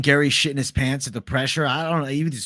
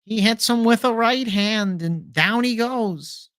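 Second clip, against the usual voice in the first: higher excited fight call, slowing at the end; Ariel Helwani commentary; 7 semitones higher, slower than usual. Retold or imitated. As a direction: call the knockout like a hyped commentator. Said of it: "The whole companion is Ariel Helwani".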